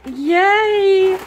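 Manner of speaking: high, loud squeal of delight; excited cheer. Real, not acted. Cheering at confetti from mail.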